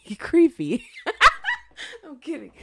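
laughing loudly. Real, not acted. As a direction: blurt it through a big laugh. Laughing at listeners.

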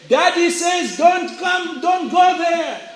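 put-on high-pitched, drawn-out child's call; children yelling back. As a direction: call back slowly in a high mock yell. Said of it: "would yell back and say".

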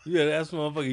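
usual speaking voice

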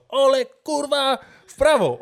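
high, drawn-out mock yell at a player; imitated coach's shout. Coach shouting directions at hapless Olek, mock yelling. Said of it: "non stop krzyczał".

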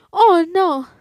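high, loud startled "oh, no"; alarmed cry. A narrator's reading. Tortilla refuses the hungry old man, alarmed cry. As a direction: recoil in alarm. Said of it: "dijo la tortilla".

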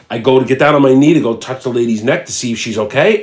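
ordinary talk, nothing marked

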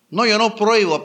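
high, loud mock denial; mocking self-excuse. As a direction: mimic someone excusing themselves. Preacher mocks a self-excuse.

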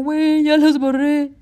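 retold insistent, slow proof-giving; mock showing proof. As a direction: stretch it out as if holding up the evidence. Acts showing him the phone.

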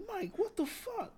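high-pitched, baffled retelling of own reaction; acted-out high incredulous question; incredulous retort. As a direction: squeak it out in disbelief. Retold reaction to friend's whisper-yelling.

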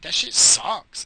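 slow, drawn-out disgust. Real, not acted. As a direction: drag it out, grossed out. Disgusted verdict on the cereal.

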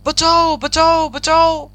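narrator's acted cry for help; shouting for help. A narrator's reading. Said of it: "Rohit जोर जोर से चिल्लाने लगा".

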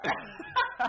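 loud, high-pitched burst of laughter; high squealing laugh. Real, not acted. Laughing at his friend's basic Vincent line.

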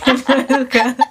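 laughing. Real, not acted. Repite riendo.

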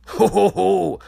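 mock laugh.

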